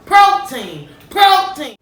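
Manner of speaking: booming, rising call of the word; loud proclamation. Acted. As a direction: announce it like a headline. Announcing protein loudly.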